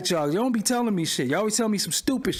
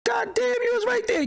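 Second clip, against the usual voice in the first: much higher, hammed-up self shock; mock shocked reaction. Streamer mocks his own reaction to old shows, high squeak. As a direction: squeak it out like you just caught yourself. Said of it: "I'm looking like".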